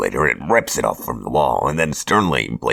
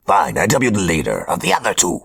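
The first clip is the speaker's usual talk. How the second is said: higher, theatrical villain voice; Hobgoblin voice; 6 semitones higher, faster than usual. Acted. Hobgoblin announces his chosen apprentices, smug villain voice. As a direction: play a smug supervillain handing out orders.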